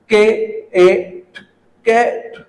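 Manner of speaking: "कैट" sound by sound, k, a, t. Shown as a demonstration. Teacher sounding out a new word, "कैट" piece by piece. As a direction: separate the letters of "कैट" with pauses. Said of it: "पहले sound out करें".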